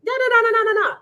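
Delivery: loud protest. Real, not acted. Cutting in to object.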